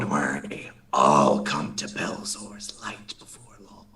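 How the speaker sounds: low unvoiced cultist whisper; menacing whisper. Villain whispers a threat to the departing player, hushed.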